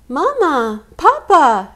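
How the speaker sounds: high, loud scared call for parents; frightened cry. Acted. Scared cub calling her parents in the dark. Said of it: "she cried".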